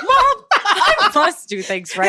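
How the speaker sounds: high, slow, hammed-up kid whine; after-school special voice. Co-host playing a kid to Mom, hammed-up high voice. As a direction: plead with Mom like an overacting TV kid. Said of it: "after school special voice".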